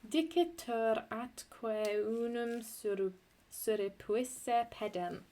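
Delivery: slow, deliberate reading of Dicitur atque unum surrepuisse pedem, syllables spaced; scanned verse reading. Shown as a demonstration. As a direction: read it slowly, marking the metre. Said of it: "This is a pentameter line".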